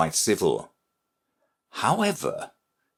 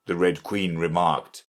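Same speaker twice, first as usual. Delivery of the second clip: plain reading voice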